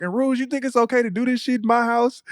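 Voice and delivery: lightly voiced angry mother; mom scolding. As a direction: call him out, imitating the mom. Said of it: "And then she was like".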